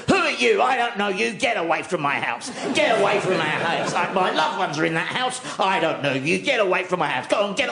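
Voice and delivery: mock-furious high barking at a stranger; angry shouting. Dog as house owner yelling at a passer-by, mock shouting. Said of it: "started shouting the, and jumping at the gate going".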